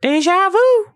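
high distant voice in the head; like a voice echoing inside your mind. Acted. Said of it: "in your head, you hear".

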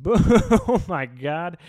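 laughing hard and loud; oh my God through real laughter. Real, not acted. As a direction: crack up at the joke, slow. Laughing at own joke.

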